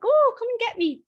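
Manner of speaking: high, loud sing-song call to the dog; playful coaxing. Shown as a demonstration. Owner calling dog to chase, high playful call. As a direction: tease the dog into chasing you.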